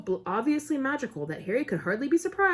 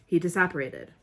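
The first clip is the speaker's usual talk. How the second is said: ordinary delivery, nothing marked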